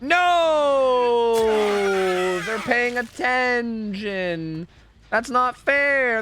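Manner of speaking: loud, high, slow protest; real gamer outrage, drawn out; frustrated shout. Real, not acted. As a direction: yell it at the unfair game. Frustrated at teammates in game.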